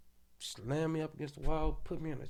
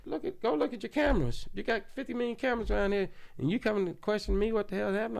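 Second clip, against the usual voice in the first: higher and louder, rushing the middle line; indignant retort; 8 semitones higher, 6 dB louder, faster than usual. Retold or imitated. Telling the sheriffs to check cameras, indignant and loud. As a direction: fire it back at them, outraged.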